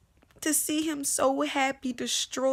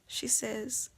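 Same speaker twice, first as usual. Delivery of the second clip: normal talking voice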